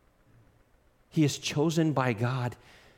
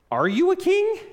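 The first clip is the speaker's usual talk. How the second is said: high, loud disbelieving question; can't believe it, pitch jumping way up; incredulous question; 6 dB louder than usual. Retold or imitated. Pilate questioning the prisoner before him, incredulous. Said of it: "He's incredulous and says".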